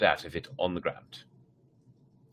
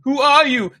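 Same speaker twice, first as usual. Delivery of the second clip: high, loud panicked question; frightened cry; 11 dB louder than usual. Acted. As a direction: shout it out, scared stiff. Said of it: "the other cried".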